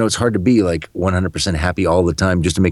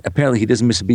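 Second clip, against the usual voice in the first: ordinary talk, nothing marked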